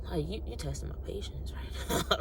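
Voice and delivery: whispered, losing patience; hushed, fed-up aside; exasperated whisper. Retold or imitated. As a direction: whisper a warning under your breath.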